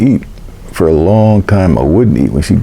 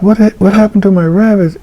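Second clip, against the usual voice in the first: mock yell, pitched way up; retold scream; 13 semitones higher than usual. Child demanding answers about the rabbits, retold high-pitched scream. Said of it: "I screamed and yelled and asked".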